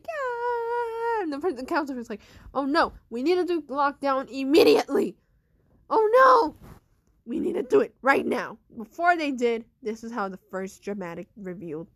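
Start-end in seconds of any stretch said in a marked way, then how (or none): 0.0-1.2 drawn-out high "gah"; imitated scream. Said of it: "he kept screaming like". 2.5-8.6 loud, urgent counselor; alarmed shouting. Acted.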